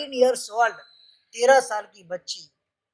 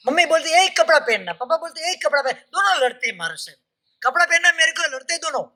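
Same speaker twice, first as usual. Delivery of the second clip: higher-pitched mock child tattling on parents; imitated child's complaint; 6 semitones higher than usual. माँ-बाप की लड़ाई बताती बच्ची. (बच्चे की नकल में ऊँचे सुर में शिकायत)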